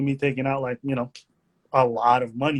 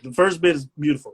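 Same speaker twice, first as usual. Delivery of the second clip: ordinary talk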